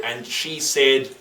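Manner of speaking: ordinary delivery, nothing marked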